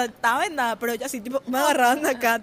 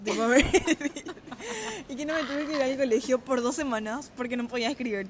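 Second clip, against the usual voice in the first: ordinary delivery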